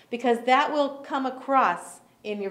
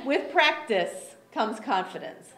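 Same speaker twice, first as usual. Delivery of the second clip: usual speaking voice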